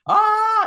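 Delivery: high, loud cartoon scream of a priest; hammed-up terrified priest scream; mock scream. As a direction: shriek as a spear falls on you.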